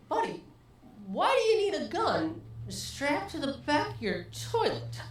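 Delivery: incredulous question. Retold or imitated. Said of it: "I said".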